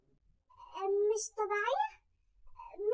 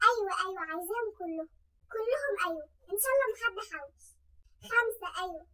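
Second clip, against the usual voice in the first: ordinary delivery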